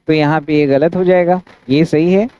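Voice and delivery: usual speaking voice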